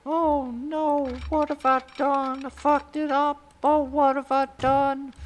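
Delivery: very high, loud hammed-up wail; hammed-up panic after breaking the track; mock panic. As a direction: squeal like a cartoon character in despair.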